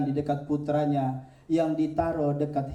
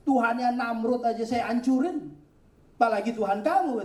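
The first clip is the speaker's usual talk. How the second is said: scornful prophet; 7 semitones higher than usual. Acted. Ibrahim scorns the idol.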